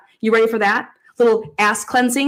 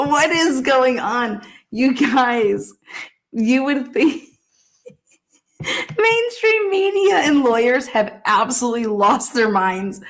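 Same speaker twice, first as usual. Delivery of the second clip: ordinary talk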